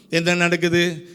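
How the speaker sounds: imitated weary complaint, pitched low; mock lamenting. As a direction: grumble through a list of woes in a low monotone. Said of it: "இதே புலம்பல் தான்".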